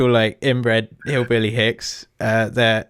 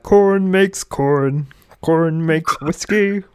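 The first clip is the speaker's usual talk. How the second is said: country singer parody; 8 semitones higher, 4 dB louder than usual. Retold or imitated. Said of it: "he's the one that did".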